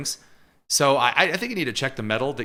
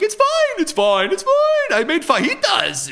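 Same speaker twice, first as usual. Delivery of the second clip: high-pitched shrieky voice; 5 dB louder than usual. Retold or imitated. Host imitates Ross from Friends losing his mind, high-pitched shrieky. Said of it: "going into high-pitched, shrieky voice mode".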